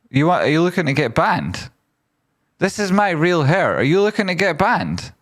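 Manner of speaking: high-pitched, put-on outrage; comic affronted squeal; mock indignation. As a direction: threaten them in a silly high voice. Mock threat to chatter about wig.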